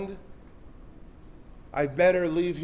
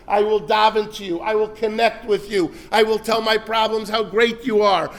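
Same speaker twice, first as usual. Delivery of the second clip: usual speaking voice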